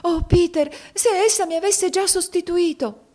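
put-on very high, distressed girl's voice; acted anguish, pitched far up. A narrator's reading. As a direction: cry it out in dread. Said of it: "esclamò con angoscia".

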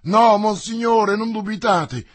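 loud, high answer to a commander; shouted reply. A narrator's reading. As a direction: shout back your assurance. Shouted answer to the yelled order. (risposta gridata al comandante)

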